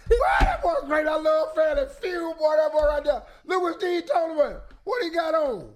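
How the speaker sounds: high-pitched hollering at the screen; hammed-up, high excited chatter. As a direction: yell comments over the television.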